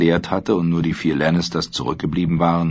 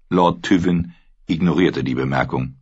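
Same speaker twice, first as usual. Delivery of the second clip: ordinary delivery, nothing marked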